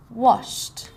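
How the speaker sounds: Washed as one syllable ending in T; teacher modelling the T ending of washed; T sound at the end. Shown as a demonstration. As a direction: say Washed alone, ED as T. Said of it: "Sounds like a T".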